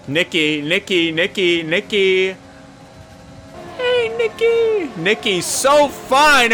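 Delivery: high, drawn-out sing-song taunt of Nicky; playful singsong calling a rival driver; teasing sing-song. Real, not acted. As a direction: croon his name to mock him while passing. Taunting rival while passing.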